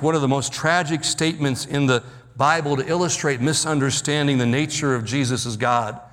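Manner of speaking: ordinary talk, nothing marked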